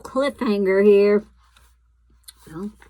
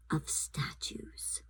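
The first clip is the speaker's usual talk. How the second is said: softly whispered; whispered narration; 5 semitones lower, 15 dB quieter, slower than usual. A narrator's reading. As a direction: hush it like a secret. Hushed narration.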